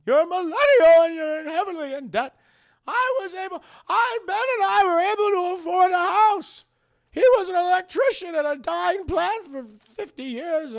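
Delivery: loud, high squealing aunt rant; squealing baby talk aunt. Retold or imitated. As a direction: shriek it like an outraged old aunt. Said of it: "That's my Aunt May voice".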